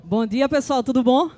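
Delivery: big cheerful hello to the crowd; loud greeting. Real, not acted. Host hailing the audience at the start of the talk, loud call-out.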